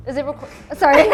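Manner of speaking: ordinary talk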